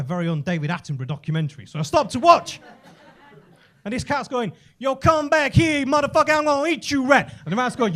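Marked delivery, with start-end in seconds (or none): 4.8-7.3 threatening cat. Acted. Cat to the rat it is chasing, loud mock threat. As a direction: play a cat yelling threats at its prey.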